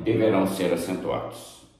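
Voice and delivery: normal talking voice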